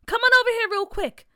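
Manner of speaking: loud call across the street; yelling. A narrator's reading. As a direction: yell it out high. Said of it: "Bria yelled".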